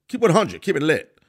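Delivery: high, loud mock-hype shout, peaking on the repeat; kids' slang catchphrase. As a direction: holler it like an overexcited kid, playfully hammed up. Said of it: "as kids say".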